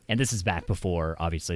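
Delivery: ordinary talk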